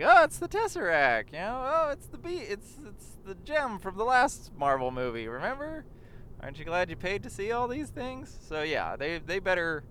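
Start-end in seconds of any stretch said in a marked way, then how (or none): 0.0-8.4 mock movie characters. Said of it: "they're all like".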